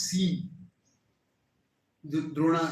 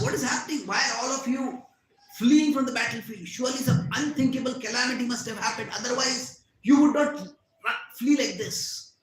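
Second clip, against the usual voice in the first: higher, raised voice of alarmed questioning; urgent demand; 6 semitones higher, 5 dB louder than usual. A narrator's reading. Ashwatthama asks the fleeing army, urgent demand. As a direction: demand answers from fleeing soldiers.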